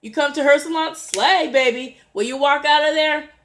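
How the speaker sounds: high, loud, sassy hype; big, high-energy encouragement; hyped-up salon cheerleader. Acted. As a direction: shout it like you're cheering a client on. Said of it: "Sister Nicole will tell you".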